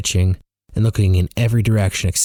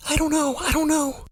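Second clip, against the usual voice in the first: very high, softened fluster; nervous falsetto repetition; flustered panic; 5 dB quieter than usual. A narrator's reading. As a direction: protest helplessly, high and small. Flustered, terrified after lying to cop.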